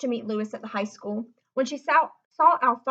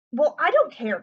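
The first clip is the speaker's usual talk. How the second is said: cried out; 4 semitones higher, 7 dB louder than usual. A narrator's reading. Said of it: "cried Lewis".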